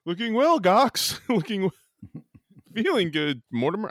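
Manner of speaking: Trading Places quote. Retold or imitated. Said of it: "Trading Places".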